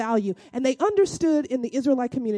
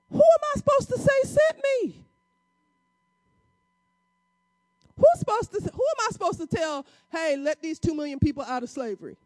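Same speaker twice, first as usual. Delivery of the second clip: high, anxious pleading as frightened Moses; scared Moses; 10 semitones higher than usual. Retold or imitated. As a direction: voice Moses's nervous doubt, pitched way up. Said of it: "Moses is a little freaked out, a little scared".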